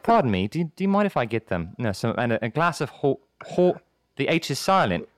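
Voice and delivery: polite, halting British diner; British accent. Acted. British customer asking for horchata, polite and halting accent. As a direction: mind your manners in a British accent. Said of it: "I love your British accent".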